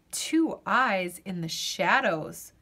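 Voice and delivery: usual speaking voice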